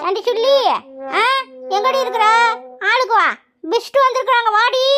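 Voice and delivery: high, loud summons; calling out. Acted. Mother-in-law summons daughter-in-law for the guests, shouting high. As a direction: holler for the daughter-in-law to come. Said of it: "நானே கூப்பிடுறேன்".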